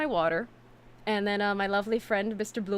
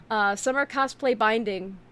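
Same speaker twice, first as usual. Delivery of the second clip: normal talking voice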